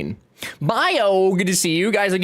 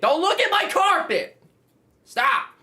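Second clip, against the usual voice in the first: high protest; 13 semitones higher, slower than usual. Real, not acted. Protesting about carpet, squealing.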